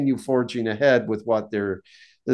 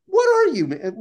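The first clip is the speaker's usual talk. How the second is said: exasperated outburst; 14 semitones higher than usual. Retold or imitated. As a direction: blurt it out, fed up and incredulous. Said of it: "just mad and exasperated".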